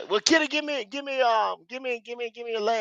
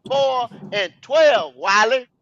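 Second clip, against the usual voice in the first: over enunciating; 5 semitones higher, 3 dB louder, much slower than usual. Real, not acted. Spelling out numbers for Wiley.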